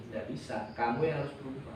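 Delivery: God answering low and soft; a calm, hushed divine reply; quiet voice of God. Retold or imitated. Preacher voicing God's answer to him, quiet and low. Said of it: "Tuhan ngomong gini".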